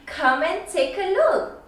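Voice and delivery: ordinary delivery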